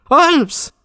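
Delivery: very high, piercing call of the name; waking a sleeper. A narrator's reading. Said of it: "quelqu'un qui aurait voulu réveiller un dormeur". (appel suraigu pour réveiller un dormeur)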